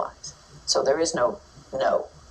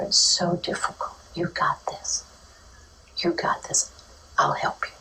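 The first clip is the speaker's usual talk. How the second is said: gentle reassurance; 3 semitones higher than usual. Shown as a demonstration. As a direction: whisper reassurance to calm them.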